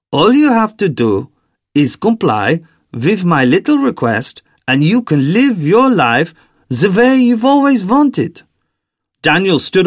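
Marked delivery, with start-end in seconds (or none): 0.1-8.4 put-on German-accented character voice, pitched up in places; soft German accent. A narrator's reading. Daniel instructs the girl before she swallows, soft German accent. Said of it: "a soft German accent".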